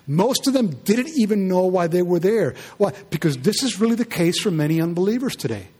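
normal talking voice

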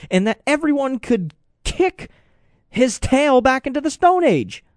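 high-pitched, fired-up rant; impassioned. Real, not acted. Host rails against the bully regime, impassioned. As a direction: drive it home with pitch held high.

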